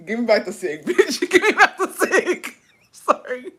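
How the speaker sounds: words squeezed out through high laughter; cracking up. Real, not acted. Reader joking over a listener's confession, cracking up. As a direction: squeal the line while giggling.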